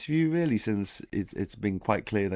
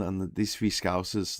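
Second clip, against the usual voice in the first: normal talking voice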